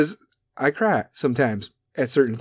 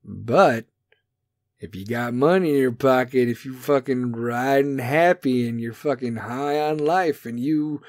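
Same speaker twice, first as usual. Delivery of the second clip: ordinary delivery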